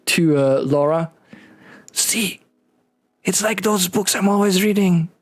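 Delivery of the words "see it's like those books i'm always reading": high, overexcited stage whisper that stays voiced; fail to whisper, pitched up with excitement; excited attempted whisper. Overexcited doctor confiding to Laura, high failed whisper. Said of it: "you hear him try to whisper".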